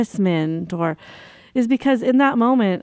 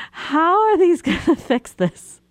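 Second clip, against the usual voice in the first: laughing disbelief; 8 semitones higher than usual. Retold or imitated. Inner thought while stunned, laughing.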